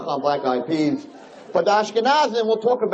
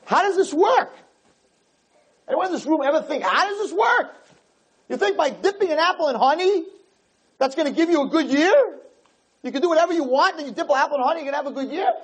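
incredulous; 10 semitones higher, faster than usual. Real, not acted. Teacher mocks apple ritual disbelief.

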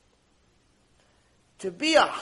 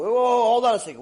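high, loud objection; acted startled interruption; 6 semitones higher, 8 dB louder than usual. Man interrupting a request for money, startled and loud. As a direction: cut in with alarm, louder.

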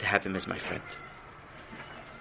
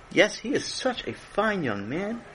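fond praise; 10 semitones higher, 3 dB quieter, slower than usual. Acted. King praises Sammy.

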